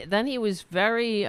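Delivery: ordinary delivery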